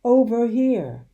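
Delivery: high, loud and slow call. A narrator's reading. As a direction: stretch the call so it carries. Father calling from pit.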